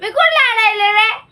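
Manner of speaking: indignant denial. Real, not acted. Sister denies crying.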